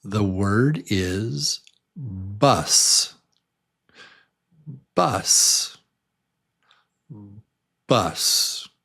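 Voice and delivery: slow, clear "bus" with a short "uh"; quiz word. Shown as a demonstration. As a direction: present the test word "bus" carefully. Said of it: "I'm gonna say one of these words".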